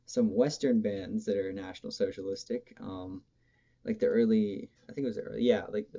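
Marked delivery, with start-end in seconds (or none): none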